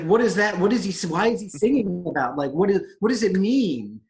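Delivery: loud, stumbling string of questions; exasperated questioning. Retold or imitated. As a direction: demand an explanation, tripping over the words. Acts out pressing the waiter for meaning.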